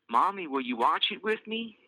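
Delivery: put-on high child voice, hopeful ask; hopeful little girl. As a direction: plead hopefully like a small girl. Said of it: "asks Elise hopefully".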